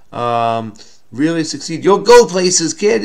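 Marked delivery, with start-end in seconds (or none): 1.8-3.0 loud, higher showbiz-style pep talk; mock encouragement. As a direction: cheer on a youngster, playfully hammed-up. Acts out an encouraging old saying.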